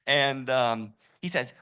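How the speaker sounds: ordinary delivery, nothing marked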